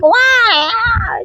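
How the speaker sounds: high drawn-out mock wail; baby screaming. Mum mimicking her baby who screams the house, high mock scream. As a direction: imitate a screaming baby's wail. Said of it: "She's just screaming the house".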